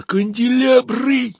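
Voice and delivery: high, loud furious yelling at the stagehands; stage manager yelling at the crew before the show, furious and high; menacing growl. Acted. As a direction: roar out orders like a frantic stage manager. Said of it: "рычал приглушённо, но очень страшно".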